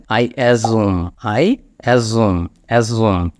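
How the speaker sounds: "assume" said slowly, ending on a held m; careful pronunciation. Shown as a demonstration. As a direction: model "assume" low and deliberate. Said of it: "উচ্চারণটা খেয়াল করবেন". ("assume" ধীরে, শেষে ম টেনে উচ্চারণ)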